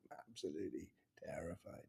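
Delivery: low, hushed whisper; confiding whisper. Retold or imitated. Co-star confiding in him at the read-through, whispered. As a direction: whisper it as a secret confession of nerves.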